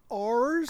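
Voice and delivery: "R's" with a long, hard American R. Shown as a demonstration. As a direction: correct them with a drawn-out, growing R in "R's". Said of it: "typical American accent with hard R's".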